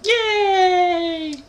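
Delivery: very high falsetto "yay"; mock cheer. Gamer celebrating a level-up, mock falsetto squeal.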